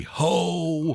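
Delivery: Santa laugh. Shown as a demonstration. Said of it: "It's supposed to be".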